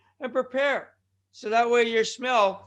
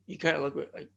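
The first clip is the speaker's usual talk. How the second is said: ordinary talk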